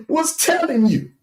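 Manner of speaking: high-pitched, laughing through the words. Real, not acted. Host ridiculing a rival's source, laughing. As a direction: let a mocking laugh break into the line.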